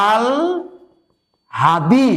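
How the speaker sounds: আল হাদি split in two, long pause after আল; teacher's drawn-apart "aal... hadi" for আল হাদি; correct pronunciation. Shown as a demonstration. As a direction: hold আল, then a clear হাদি. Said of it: "এর উচ্চারণটা হবে হচ্ছে".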